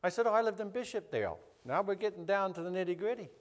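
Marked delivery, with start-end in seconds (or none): 0.3-1.4 higher-pitched, light reply; retold reply with a lifted pitch; lively retold reply. Trading hometowns, his own reply.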